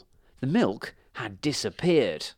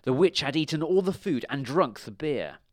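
ordinary delivery

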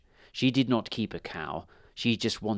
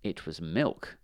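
usual speaking voice

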